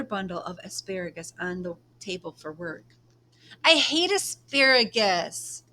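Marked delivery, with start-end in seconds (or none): 3.6-5.6 put-on whiny child. Girl complains about dinner vegetable.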